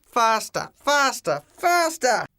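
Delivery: high frantic yell building at the driver; rising yell. Acted. Urging driver, rising panic.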